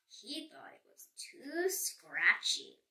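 usual speaking voice

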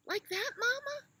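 put-on very high, eager baby voice; little elephant. Baby elephant asking mama after trumpeting, high. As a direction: squeak it out hopefully.

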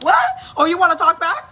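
high, loud mock outrage; snapping angry mom. Imitating her mom challenging a questioning child, angry yell. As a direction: pounce on the backtalk like a strict mother. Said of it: "if it was my mom".

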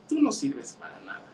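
high, soft put-down; belittling. A narrator's reading. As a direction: dismiss them in a small high voice. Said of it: "lastimaba mucho a la otra y le decía".

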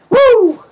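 high, loud whoop of joy; a gleeful yell of delight; excited woo. Real, not acted. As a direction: let out a big happy woo. Excited cheer.

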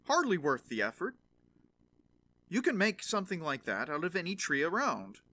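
loud dismissive scoff. A narrator's reading. Father scoffing at his son's club, loud and dismissive. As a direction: brush the idea off, loudly unimpressed.

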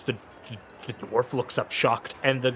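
usual speaking voice

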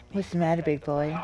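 soft mock-menacing taunt; spooky tease. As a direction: taunt him in a hushed menacing voice. Creepy taunting continues.

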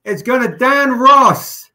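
loud, high announcing of the winner; hyped livestream winner shout-out; excited announcement. Real, not acted. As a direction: call out the winner's name with a burst of excitement.

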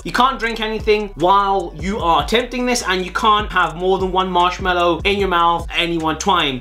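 ordinary talk

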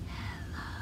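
breathy whispered hello, barely there. Shown as a demonstration. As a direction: whisper it as quietly as you can. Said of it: "Let's say hello as quiet as we can".

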